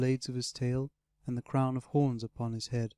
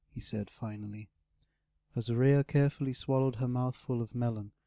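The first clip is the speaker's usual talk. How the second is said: plain reading voice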